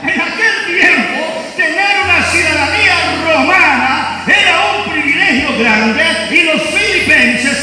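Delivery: voz de predicador, más aguda y enérgica; predicando con fuerza. Acted. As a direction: proclama a la congregación con fervor. Pastor preaching about Filipos. (predicación apasionada)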